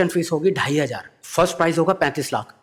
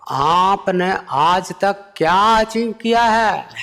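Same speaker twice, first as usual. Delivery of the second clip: very slow, higher voice; Modi impression; 7 semitones higher, 3 dB louder, much slower than usual. Modi gravely asking what the comedian achieved. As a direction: ask it like a grave speech question. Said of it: "फिर वो बोले".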